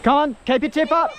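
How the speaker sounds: loud, high coaching call; excited shout. Real, not acted. Coaching kid fighting a fish.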